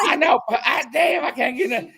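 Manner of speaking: high, laugh-choked voice; laughing helplessly. Real, not acted. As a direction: try to talk through real laughter. Helpless laughter after poultry slip.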